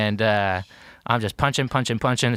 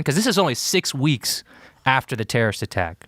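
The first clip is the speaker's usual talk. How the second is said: ordinary talk, nothing marked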